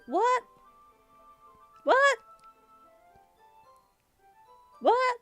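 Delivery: high, rising squawk of disbelief; incredulous. Real, not acted. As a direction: shoot the pitch right up in bafflement. Repeated disbelief at the dialogue.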